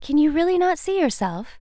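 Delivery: can't believe he doesn't see it; disbelieving butterfly. Acted. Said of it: "the butterfly asked".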